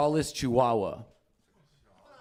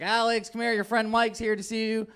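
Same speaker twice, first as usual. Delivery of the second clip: higher-pitched imitation of a mom calling out; light mock mom voice, calling through the house; high, sing-out call to a son; mom calling her kid; 9 semitones higher than usual. Friend's mom calls her son.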